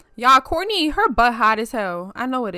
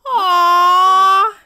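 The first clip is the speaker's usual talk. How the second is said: loud, high, long-held aww; big "aww"; 7 semitones higher, 9 dB louder than usual. Real, not acted. Cooing at the family meal.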